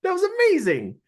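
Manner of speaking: high, loud amazed outburst; high-pitched "whoa, dude" disbelief; amazed shout. Retold or imitated. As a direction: blurt out your awe at the locksmith. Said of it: "And I was like".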